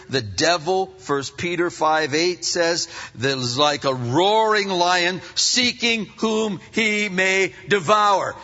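ordinary delivery

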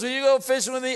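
imitating an eager husband; lightly voiced husband asking; hopeful husband. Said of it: "And he goes".

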